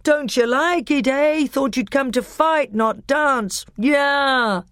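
loud, high taunting crowd jeers; jeering. A narrator's reading. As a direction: taunt the fighter from the stands. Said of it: "booed the Telmarines".

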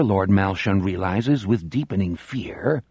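plain reading voice

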